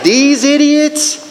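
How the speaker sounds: high, slow mock incredulity; acted-out appalled contempt; judging disbelief. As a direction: stretch it out in scornful inner protest. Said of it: "I'm thinking that. I didn't say it".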